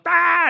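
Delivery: high-pitched angry scream imitation; imitated mad yell. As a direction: mimic the driver losing it. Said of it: "And he gets mad".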